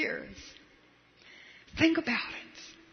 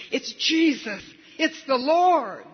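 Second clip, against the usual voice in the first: louder, rising exclamation of sudden recognition; excited cry; 4 semitones higher, 5 dB louder, slower than usual. Acted. John on the boat shouting to Peter, excited recognition. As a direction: call it out as the truth hits you.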